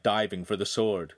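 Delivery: usual speaking voice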